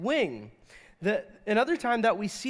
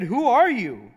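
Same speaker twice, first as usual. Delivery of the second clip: raised, loud startled question; 5 semitones higher, 9 dB louder than usual. A narrator's reading. Man waking at midnight to a woman, startled. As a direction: voice jumps up in startled challenge. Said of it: "the man was startled".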